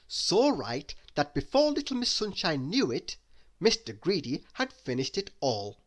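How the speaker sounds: ordinary delivery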